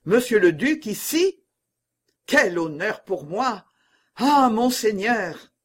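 exclaim, overawed by a grand guest; awed exclamation. A narrator's reading. Broussel receives the duke at his bedside, awed exclamation. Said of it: "s'écria Broussel".

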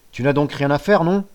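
stern scolding father; gruff father. Acted. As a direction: growl it at the lazy son. (père bourru qui gronde)

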